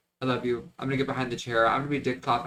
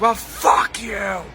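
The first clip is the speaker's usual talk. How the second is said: slow, stretched-out, higher yell, louder; retold yell; 8 semitones higher, 5 dB louder, much slower than usual. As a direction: drag out the curse like a furious customer. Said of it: "So loud".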